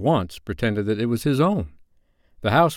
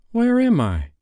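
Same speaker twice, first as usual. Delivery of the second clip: high-pitched, wondering question; Wendy's astonished high voice; surprised; 12 semitones higher than usual. A narrator's reading. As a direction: ask in high, girlish surprise. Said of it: "She looked properly surprised".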